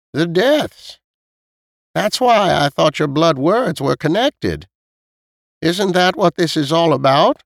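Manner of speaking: put-on high-pitched elderly lady; old woman probing her listeners, pitched high; questioning old woman. Voices old Missus Knight.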